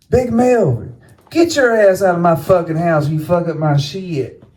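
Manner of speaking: high-pitched imitation of an angry mama; retold scolding. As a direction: order him out like a fed-up mother. Mother scolds father in retold quarrel.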